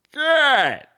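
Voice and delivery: exasperated cry. Real, not acted. Gamer sorting terrible gear, crying out exasperated. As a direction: wail it in disbelief.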